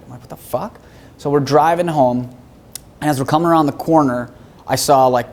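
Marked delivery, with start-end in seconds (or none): 0.2-0.8 soft, stunned question rising at the end; retold stunned disbelief. Son answering news that mom died, hushed disbelief. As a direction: reenact quiet shock. Retold shock at mother's death.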